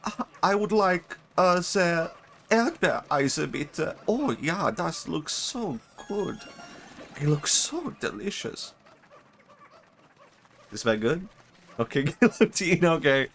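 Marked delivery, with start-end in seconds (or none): 0.0-8.7 high-pitched, hammed-up German customer; exaggerated German accent, drawn out and high; mock German accent. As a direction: order politely in a high put-on German voice. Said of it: "I said it like this".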